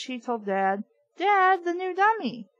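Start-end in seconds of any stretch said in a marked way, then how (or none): 1.2-2.5 higher urgent cry; cried out. A narrator's reading. Trina crying out to Dad, higher. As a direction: call out to Dad. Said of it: "I cried".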